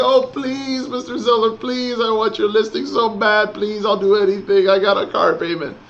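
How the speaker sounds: high, pleading, groveling voice; begging agent. Retold or imitated. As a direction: beg the seller like a desperate agent. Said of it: "we're begging and groveling".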